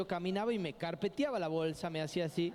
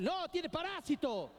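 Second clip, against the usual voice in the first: much higher, slow mock mom; mother's flat refusal; 12 semitones higher than usual. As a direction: drag it out like a stubborn mom. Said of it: "doña Mari". (la madre niega, voz muy aguda y lenta)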